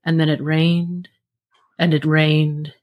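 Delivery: slow, low narrator impression; ominous ride narrator. Sharing the old Living Seas narration, slow solemn voice. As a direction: intone it slowly and deep, like a recorded voice-over.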